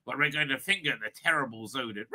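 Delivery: low, soft deadpan delivery; understated Doctor impersonation. Acted. Voicing the Doctor's imagined line again.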